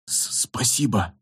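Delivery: quiet, choked thanks; soft and slow after tears; tearful thanks. A narrator's reading. Fred after crying. (сдавленно, после слёз)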